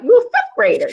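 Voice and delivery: put-on retelling her stunned reaction to the girl; shocked disbelief. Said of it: "I was shocked".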